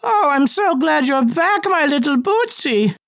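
put-on high, slow, cooing old lady; doting old woman. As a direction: coo at a beloved pet.

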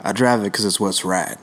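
slow actor impression, pitched a little down; Matthew McConaughey. Impressionist voices an actor for guessing friends, slow musing drawl. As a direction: take it slow, like the film star musing. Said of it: "Is it Matthew McConaughey?".

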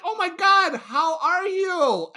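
high, loud gushing hello; squealing delighted greeting, drawn out; excited greeting. Retold or imitated. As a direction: gush over an old friend. Sister delighted to see him.

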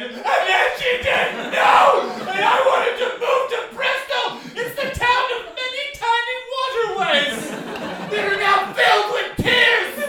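high, loud, hammed-up breathless crying plea; mock sobbing wail. Liar sobs to the train guard, high, loud, hammed-up wailing. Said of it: "out of breath and just crying".

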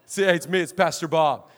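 low, jovial mimicked greetings; father-in-law impression. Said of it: "And he talks like this".

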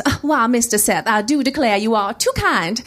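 sugary mock Southern accent; Southern debutante. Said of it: "my best Southern debutante voice".

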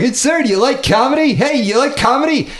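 high, pushy street tout; New York comedy hawker. Acted. New York comedian accosting passers-by, high mock pestering pitch. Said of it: "same thing with the comedians in New York".

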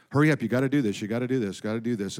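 low, hushed, insistent nagging; Satan nagging. Acted. Satan pestering a believer to hurry up, low insistent nagging. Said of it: "God never nags. Satan always does".

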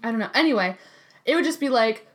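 ordinary delivery

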